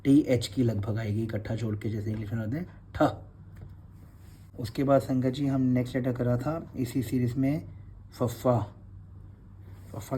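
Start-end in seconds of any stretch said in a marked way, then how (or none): 2.9-4.6 ठ whispered as a breathy aspirated retroflex t; isolated letter sound. Shown as a demonstration. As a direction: breathe out ठ alone, voiceless and aspirated. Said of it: "इसकी sound है ठ".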